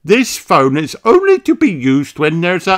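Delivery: a cheerful rabbit lady; Miss Rabbit. A narrator's reading. Miss Rabbit greets the children, high chirpy voice. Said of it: "says Miss Rabbit".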